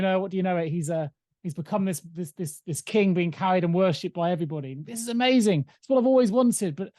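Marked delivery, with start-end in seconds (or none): 4.7-6.7 delighted. Acted. Stonecutter delighted as a king.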